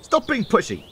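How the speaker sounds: high, cross snap; snapping. A narrator's reading. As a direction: snap at your twin. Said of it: "Bill snapped".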